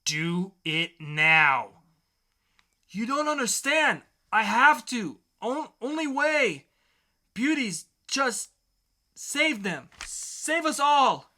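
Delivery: a desperate zealot begging to be understood; overacted raving villain; hammed-up fanatic. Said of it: "dramatic reading".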